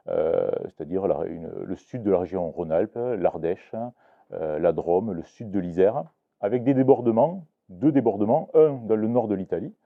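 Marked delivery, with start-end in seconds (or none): none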